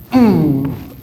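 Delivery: loud falling "mmm" for the swing; effort hum. Shown as a demonstration. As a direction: hum the push of the swing loudly.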